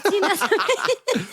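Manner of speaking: laughing through a posh Essex try; posh Essex accent. Pretend. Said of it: "Posh Essex".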